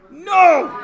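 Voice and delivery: sudden high, loud shout; emphatic no. Real, not acted. Teacher stopping a wrong answer, high loud shout. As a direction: burst out with a loud objection.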